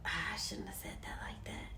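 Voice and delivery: hushed, quick whisper to oneself; regretful self-talk. Retold or imitated. Voicing her regret after speaking.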